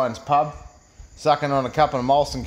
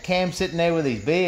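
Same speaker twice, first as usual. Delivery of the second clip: usual speaking voice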